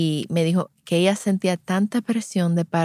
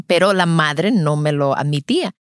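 usual speaking voice

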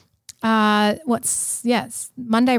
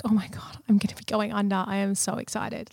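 soft, hushed giddy whisper; excited whisper; 8 dB quieter than usual. Retold or imitated. Before the sedation, a hushed giddy whisper. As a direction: whisper it like a guilty treat. Excited about going under.

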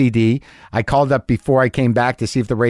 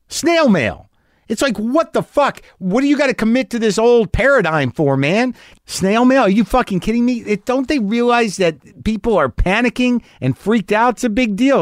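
high, indignant outrage at the snail mail; livid rant; 9 semitones higher than usual. Real, not acted. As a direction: rant like you can't believe it. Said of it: "I was a little livid".

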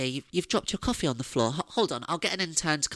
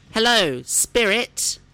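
loud, higher summoning call; 7 semitones higher, 9 dB louder, much slower than usual. Acted. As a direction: call out to the spirit. Calling to a spirit.

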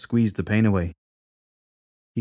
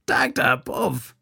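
biting down on pain, slow; through clenched teeth; 3 semitones higher, slower than usual. Acted. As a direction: squeeze it out through gritted teeth. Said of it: "he said through clenched teeth".

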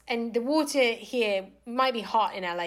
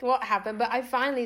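ordinary delivery

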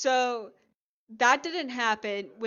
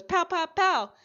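mock gunshots; 7 semitones higher, 5 dB louder than usual. Girlfriend shoots the suspect.

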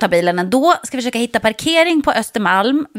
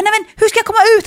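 much higher, louder, fast blurted question; panicked rush; 11 semitones higher, 4 dB louder, much faster than usual. Retold or imitated. To the old man offering help, frantic rushed question. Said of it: "jag hade panik".

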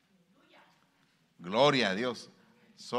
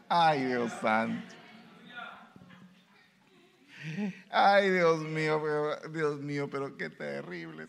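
reluctant forgiver; 4 semitones higher than usual. Acted. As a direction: moan about having to forgive. Acting a reluctant forgiver's lament. (lamento exasperado del que no quiere perdonar)

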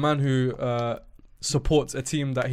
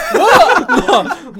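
laughing; 14 dB louder than usual. Real, not acted. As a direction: burst of laughter on what. Laughing shock at own remark.

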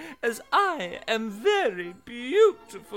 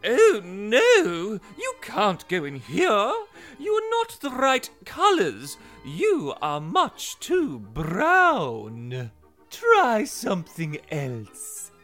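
haughty; 5 semitones higher, 6 dB louder, slower than usual. Acted. Fish refuses Pebble haughtily.

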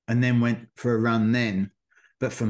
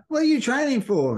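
put-on much higher, chatty little old lady; 13 semitones higher than usual. Elderly woman asks a resting runner in a high voice. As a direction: ask it like a curious grandma leaning over the fence. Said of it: "she goes".

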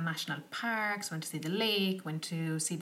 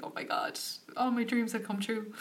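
high, airy overjoyed sigh; like every wish just came true; dreamy delight; 5 semitones higher than usual. Retold or imitated.

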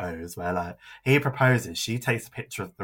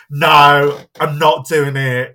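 firm refusal; 5 semitones higher, 14 dB louder, much slower than usual. Retold or imitated. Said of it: "And Robin was like".

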